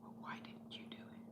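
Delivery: hushed, scolding whisper; mother's angry whisper. Retold or imitated. Mother scolding daughter about the trash, in a whisper. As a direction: scold in a whisper so nobody hears.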